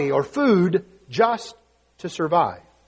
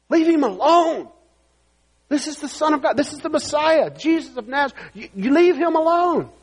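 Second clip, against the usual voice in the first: high, indignant rebuke; scolding; 9 semitones higher than usual. Acted. Crowd telling blind man to leave Jesus alone, scolding. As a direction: scold him in a raised pitch.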